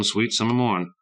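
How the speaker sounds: ordinary delivery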